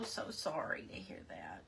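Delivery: whispered sympathy. Real, not acted. Sympathizing with a viewer's bad news.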